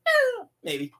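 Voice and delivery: high squeaky noncommittal shrug; high-pitched playful maybe; shrugging tease. Acted. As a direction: toss it off with a shrug. Shrugging aside.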